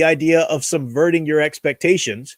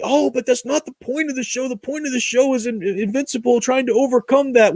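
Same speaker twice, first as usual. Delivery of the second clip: higher, whiny mock-defensive voice; mocking critics; 7 semitones higher than usual. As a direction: parody the fans who defend the show. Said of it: "people are gonna tell me".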